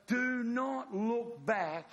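very slow, high, emphatic warning; grave command. Acted. Angel warning Lot's wife as they flee, slow stern command. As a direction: space out each word as an order.

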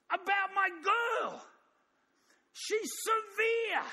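pleading prayer that builds into a high shout; a desperate mother begging God for her girl; mother crying out. Acted. Mother crying out to God for her daughter, pleading then shouting high. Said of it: "and she's crying out to God".

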